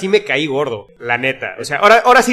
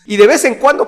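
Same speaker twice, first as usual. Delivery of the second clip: exasperated quote; 7 semitones higher than usual. Retold or imitated. Reads an irritated listener's comment.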